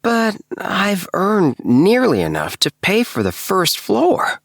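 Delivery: bubbling over about his finds; lightly voiced eager Appleby. A narrator's reading. Appleby telling the narrator his plans, lightly eager.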